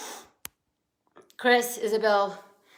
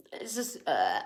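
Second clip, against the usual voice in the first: tearful whisper; 11 semitones lower, 6 dB quieter than usual. Real, not acted. Overcome after crying on live.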